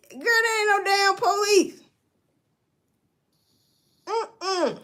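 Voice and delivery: high, sassy woman brushing it off; dismissive girlfriend. Retold or imitated. Wife dismissing police at door.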